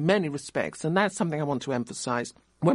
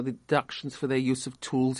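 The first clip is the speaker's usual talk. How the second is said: ordinary delivery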